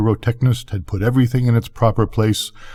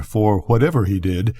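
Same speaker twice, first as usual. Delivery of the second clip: usual speaking voice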